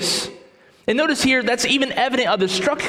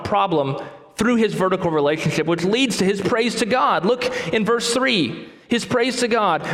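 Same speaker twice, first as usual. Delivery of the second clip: ordinary talk, nothing marked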